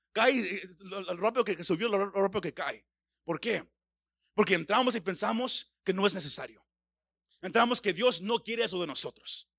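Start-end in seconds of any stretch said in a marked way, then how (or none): none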